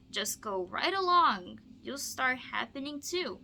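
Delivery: lively, expressive storybook read-aloud; bouncy rhyming picture-book voice; reading to children. Acted. Teacher reads Seuss to student.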